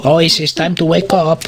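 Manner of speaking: ordinary talk, nothing marked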